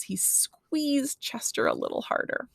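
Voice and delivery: plain reading voice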